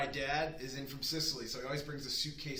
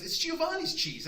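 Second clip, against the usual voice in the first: very high, louder mock voice of dad; hammed-up old father; dad naming the cheese, lightly mocked; voicing dad; 6 dB louder than usual. Dad quoted in story.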